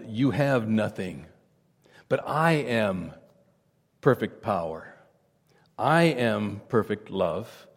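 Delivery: low, slow and soft, tender reassurance; a gently voiced God soothing the speaker; gentle voice of God. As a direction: speak as God would to a broken child, gently. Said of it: "speak to me very gently".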